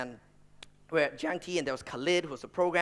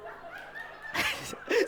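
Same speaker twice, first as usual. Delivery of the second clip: much higher breathy laugh; 5 dB quieter than usual. Real, not acted. Speaker cracking up at his own dark side joke, breathy laugh. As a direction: let out a high airy laugh.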